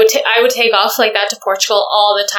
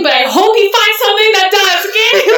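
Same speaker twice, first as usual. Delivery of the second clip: sassy retort; 10 semitones higher than usual. Retold or imitated. Retold sassy reply to passengers.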